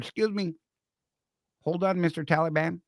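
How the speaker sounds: timidly polite send-up of a stressed soldier; mock politeness. Stressed private asking the Taliban to pause, mock-polite.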